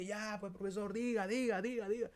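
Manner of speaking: high, pushy urging; impatient students. Retold or imitated. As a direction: press the teacher to say it. Estudiantes apuran al profesor. (estudiantes impacientes insistiendo)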